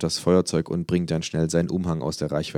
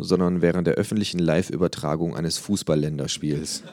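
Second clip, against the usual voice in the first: ordinary delivery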